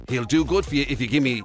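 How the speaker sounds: normal talking voice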